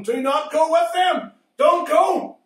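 God's voice forbidding, loud and high; stern divine command, shouted. Acted. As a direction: boom out the command. God forbids Balaam, shouted.

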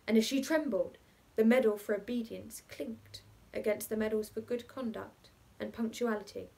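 ordinary delivery

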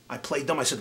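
ordinary talk

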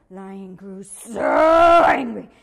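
slow, loud angry growl; slow growling anger. A narrator's reading. Narrator builds lion's anger.

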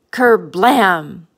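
loud spoken explosion noise; punchy storybook kerblam; cannon blast. A narrator's reading. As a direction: boom it out like cannon fire.

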